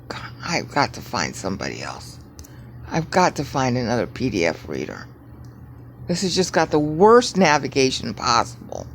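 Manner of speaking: ordinary talk, nothing marked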